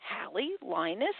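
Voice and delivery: ordinary delivery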